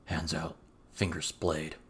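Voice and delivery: quiet menacing order to a captive; cold command. A narrator's reading. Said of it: "he said".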